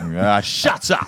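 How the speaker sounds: loud, drawn-out shut up; mock yell. Host yelling in jest at the dog outside, mock yell. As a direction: holler it out slowly.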